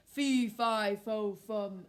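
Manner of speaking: loud giant chant; booming giant. Acted. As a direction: boom it like a giant.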